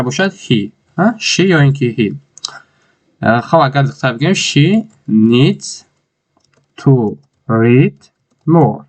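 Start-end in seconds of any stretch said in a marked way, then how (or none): 4.3-8.8 very slow, stretched for the class; slow dictation. Shown as a demonstration. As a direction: draw out each word as a model. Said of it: "teacher dictating slowly". (خیلی آهسته و کشیده برای دیکته)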